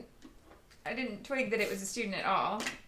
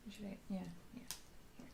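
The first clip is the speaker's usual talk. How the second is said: whisper; 11 dB quieter than usual. Real, not acted. Whispered aside while crafting.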